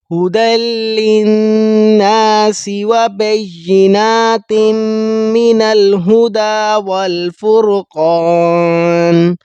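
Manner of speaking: slow melodic Quran chanting; Quran recitation. Acted. (قرآن کی تلاوت، آہستہ اور لحن کے ساتھ)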